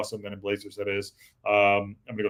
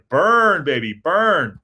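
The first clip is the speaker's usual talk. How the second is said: gloating cheer; 5 semitones higher, 8 dB louder, much slower than usual. Real, not acted. Gloating over a viewer's roast.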